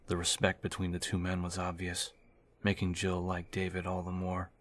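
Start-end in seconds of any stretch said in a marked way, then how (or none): none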